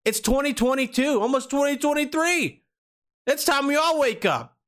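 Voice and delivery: high-pitched, impassioned rallying cry; worked-up, high-pitched rant; passionate outburst. Real, not acted. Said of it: "I got really passionate there".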